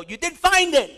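high, loud gotcha; triumphant retort. Retold or imitated. Pastor crowing at the man as he hangs up, loud. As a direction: crow the win over him. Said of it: "I go".

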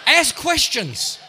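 high, loud and slow; loud emphatic advice. Real, not acted. Comic preacher drives advice home, loud and drawn out. Punchline advice repeated louder.

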